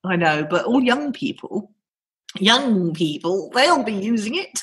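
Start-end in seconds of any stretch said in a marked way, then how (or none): 2.4-4.6 granny voice. Pretend. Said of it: "Is that your granny voice?".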